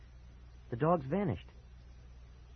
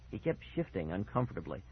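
ordinary delivery

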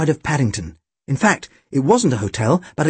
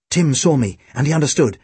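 ordinary delivery

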